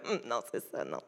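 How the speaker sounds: very low, hushed mumble; mock sheepish murmur, trailing away; acted awkward backtracking. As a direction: shrink into a small, low reply. Acted reluctant reply to podcast boast.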